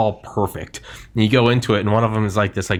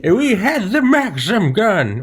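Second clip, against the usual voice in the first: put-on old-timer voice; 10 semitones higher, slower than usual. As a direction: boast like a proud veteran. Joins old-timer bit.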